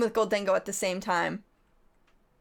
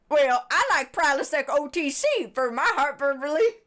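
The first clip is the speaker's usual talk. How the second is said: Tomater voice; 4 semitones higher, 4 dB louder than usual. Retold or imitated. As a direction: twang it like a goofy tow truck. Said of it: "And Tomater says".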